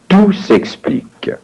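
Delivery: ordinary talk, nothing marked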